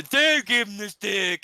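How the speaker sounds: high, loud, drawn-out put-on voice; skit character quote. Podcaster quotes his favourite skit line, loud put-on voice. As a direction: call it out slowly in a silly high voice.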